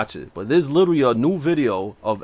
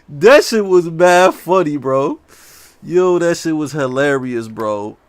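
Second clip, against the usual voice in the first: laughing; 5 dB louder, slower than usual. Real, not acted. Still laughing at the impression.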